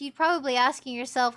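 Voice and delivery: ordinary talk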